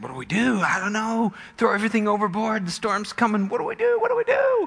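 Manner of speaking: high, frantic back-and-forth; acted panic climbing to a squeal; panicking crew. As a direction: gabble in mock desperation, pitch rising. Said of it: "everybody's asking".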